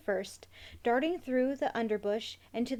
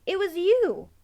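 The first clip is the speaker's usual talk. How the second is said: high, loud retort; annoyed; 7 semitones higher, 7 dB louder than usual. A narrator's reading. Emmy hits back at the rat's complaint, annoyed. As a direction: throw the blame back, pitched up and loud. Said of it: "Emmy was annoyed".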